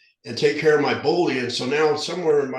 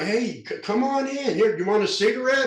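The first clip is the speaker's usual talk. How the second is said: put-on high, inviting kid voice; friendly misfit kids; 10 semitones higher than usual. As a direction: coax him in like a cool kid. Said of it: "They're like".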